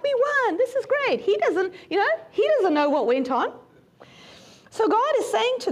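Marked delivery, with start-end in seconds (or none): 0.0-1.2 1 cheerful Joshua. Retold or imitated. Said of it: "He's just like".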